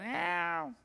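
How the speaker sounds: small, unintimidating cub meow; lion cub cry. Retold or imitated. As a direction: imitate a lost lion cub's cry. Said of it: "he's just making the noises that lion cubs make".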